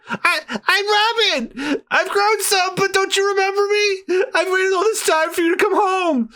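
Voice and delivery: high crying voice; a daughter crying to her mother; tearful plea. Acted. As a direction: plead through tears. Grown Robin pleads, crying.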